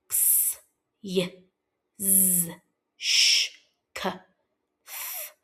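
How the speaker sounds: each letter as its isolated phonic sound. Shown as a demonstration. Teacher leading pupils through a sound chart, crisp isolated phonics sounds. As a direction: say the chart's sounds one by one, pausing between. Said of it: "say all of the sounds on this sound chart".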